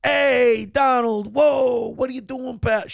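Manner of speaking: high, shouting mock Scaramucci; hammed-up high yelling guy; high mock Italian shout. Mock Scaramucci pleading Trump.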